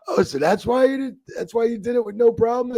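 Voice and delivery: high, loud incredulous Chris; laughing realization of the favor; amused realization. Retold or imitated. Chris realizing why he agreed, high and amused.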